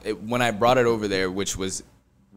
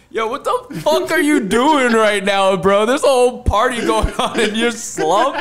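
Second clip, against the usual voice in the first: higher, louder shout, slow and laughing; startled yell; 10 semitones higher, 10 dB louder than usual. Retold or imitated. Friend yelling at the sleeping host, loud and laughing. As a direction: yell it like you got caught off guard. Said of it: "And I started screaming".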